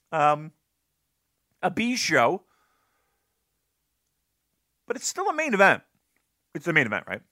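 usual speaking voice

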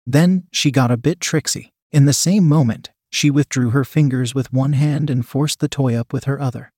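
ordinary delivery, nothing marked

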